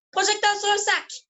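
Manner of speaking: high, slow, projected announcement; called-out stage cue. Acted. Performer quotes the cue that set the rabbit jumping, called out. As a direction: call the lighting cue out across the theatre.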